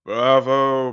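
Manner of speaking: high, loud, ringing cry of bravo. Acted. Host cheering the Sparrow's performance, loud and bright.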